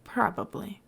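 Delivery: Probably. tossed off in a whisper; hushed, sheepish aside; whispered afterthought. A narrator's reading. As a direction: mutter it under your breath. Scar's trailing afterthought.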